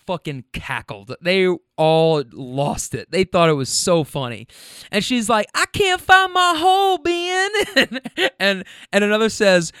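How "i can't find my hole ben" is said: very high, loud mock squeal; hysterical passenger. Passenger to friends in back seat, squealing mock-hysterically. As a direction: squeal it like a woman losing it laughing. Imitating passenger helpless with laughter.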